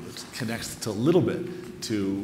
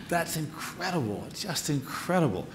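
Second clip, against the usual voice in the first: laughing through the praise, pitch up; can't stop laughing, delighted; 8 semitones higher, 6 dB louder than usual. Real, not acted. Host laughing after the reading, delighted and amazed.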